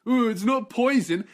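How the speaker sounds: mock the know-it-all with a sing-song sneer. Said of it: "How you think he's so smart?".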